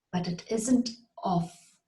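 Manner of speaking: usual speaking voice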